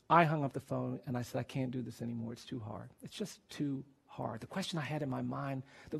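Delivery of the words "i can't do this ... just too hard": very soft, defeated retelling; hushed, worn-down giving up; quiet despair. Retold or imitated. Retelling his despair after the execution.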